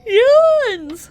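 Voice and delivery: huge loud real yawn; big yawn. Real, not acted. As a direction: let out a long loud yawn. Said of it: "I'm so sorry".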